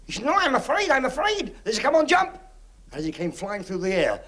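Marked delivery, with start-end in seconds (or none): 0.2-1.5 high, pleading mock fear; a hammed-up frightened plea, much higher; scared pleading. Man refuses to jump into blanket, high mock fear. 1.8-2.4 urging call. Acted. Crowd urging man to jump.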